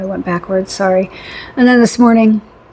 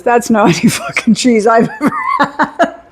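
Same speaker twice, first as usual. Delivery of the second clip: mock indignation dissolving into laughter; imitated disbelief, laughter taking over the end; laughing imitation; 3 semitones higher than usual. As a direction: retell his verdict while cracking up. Retelling husband's verdict, cracking up.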